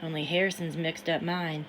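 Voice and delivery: ordinary delivery, nothing marked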